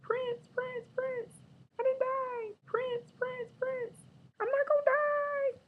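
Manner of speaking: ridiculous high-pitched chant. Shown as a demonstration. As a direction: chirp it like an excited fan. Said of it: "say it in that ridiculous high-pitched voice".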